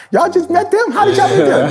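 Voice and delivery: high, laughing prim lady voice; mock her puzzled surprise, laughing.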